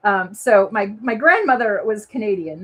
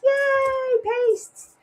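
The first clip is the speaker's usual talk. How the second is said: excited; 13 semitones higher than usual. Real, not acted. Cheering about the prize pastes.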